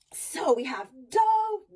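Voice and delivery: slow sounding out; stretched syllable. Shown as a demonstration. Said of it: "sounding out syllable".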